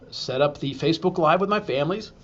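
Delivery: ordinary delivery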